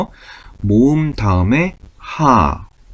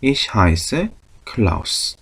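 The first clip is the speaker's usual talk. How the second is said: Ich heiße Klaus drawn out very slowly; slow model sentence; much slower than usual. Shown as a demonstration. Teacher showing a second way to say it, very slow. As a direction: spell it out in speech, one word at a time. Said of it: "혹은".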